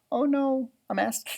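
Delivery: usual speaking voice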